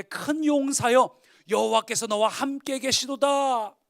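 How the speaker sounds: loud, slow proclamation of an angel; the angel greeting Gideon with a loud, slow blessing; solemn angel. Acted. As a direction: announce it slowly like a heavenly messenger. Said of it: "이렇게 기드온에게 말합니다".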